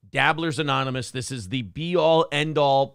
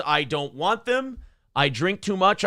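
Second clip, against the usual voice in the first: normal talking voice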